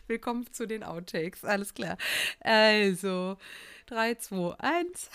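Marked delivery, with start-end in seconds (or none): none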